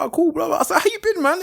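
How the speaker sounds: high, sing-out catching-up voice; retold chummy reply. Manager catching up with a man he once managed, high chummy imitation.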